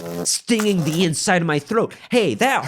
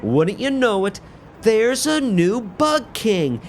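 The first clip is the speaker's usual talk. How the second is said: normal talking voice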